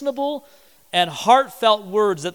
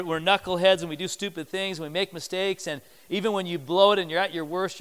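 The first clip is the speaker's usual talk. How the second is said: ordinary talk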